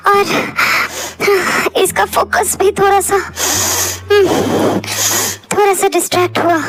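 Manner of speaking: high, gasping, stammering cry; struggling tearful girl. Acted. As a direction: fight for each word through sobs. (हाँफती, Rundhi, हकलाती आवाज़)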